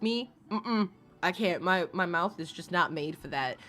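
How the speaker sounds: ordinary delivery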